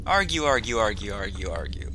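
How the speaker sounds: mock bickering. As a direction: run of "argue" sliding steadily down and fading. Mock summary of characters bickering.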